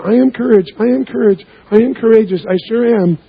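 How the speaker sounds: lightly mocking, high, sing-song chant of I am courage; hollow affirmation. Telling the crowd how she could have said it, empty and sing-song. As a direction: parrot the words without meaning them. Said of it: "She could have gone".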